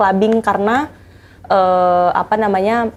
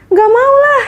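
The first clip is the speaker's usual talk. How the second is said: squealed high and loud refusal, much higher; shrill refusal; 5 dB louder, slower than usual. Retold or imitated. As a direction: shriek a frightened no.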